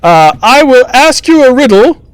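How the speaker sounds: high-pitched court jester master of ceremonies; theatrical jester, far above normal pitch; jester announcing contest. Acted. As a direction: announce the rules in a piping jester voice.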